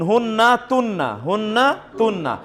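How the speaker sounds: হুন্না তুন্না with a long held double n; doubled n. Shown as a demonstration. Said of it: "তাসদিদ যুক্ত নুন". (হুন্না তুন্না দ্বিত্ব ন টেনে)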